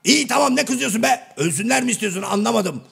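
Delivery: high-pitched comic indignation; indignant protest. Acted. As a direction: protest as if wrongly scolded. Protests at being snapped at.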